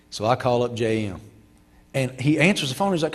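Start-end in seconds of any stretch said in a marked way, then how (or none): none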